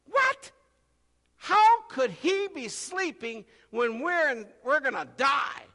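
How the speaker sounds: high, loud, incredulous outburst; shocked that he can sleep now; perplexed disbelief. Retold or imitated. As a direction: disciples aghast at the sleeping Jesus.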